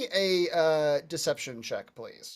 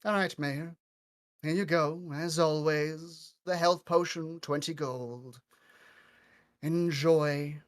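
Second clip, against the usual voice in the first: put-on elderly elf woman; 3 semitones lower, 7 dB quieter, slower than usual. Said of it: "she says".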